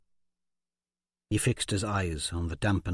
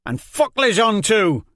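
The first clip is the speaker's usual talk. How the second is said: much higher, loud blasphemous yell; defiant curse; 11 semitones higher, 9 dB louder than usual. Acted. Jenko curses Les Jean, loud defiant yell.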